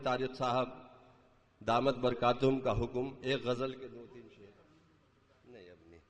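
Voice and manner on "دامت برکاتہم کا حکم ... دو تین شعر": ordinary talk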